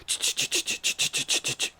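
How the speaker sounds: rapid-fire "ch-ch-ch" chain rattle; voiceless sound effect of jingling; imitated jingling chains. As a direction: chains rattling at a hard run. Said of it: "But it go".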